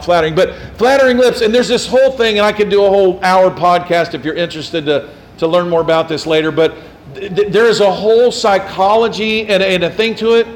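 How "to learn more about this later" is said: usual speaking voice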